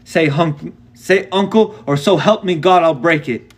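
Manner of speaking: put-on the boy threatening through pain, wailing; wailing threat. A narrator's reading. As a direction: wail it out in desperate rage. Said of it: "He wailed".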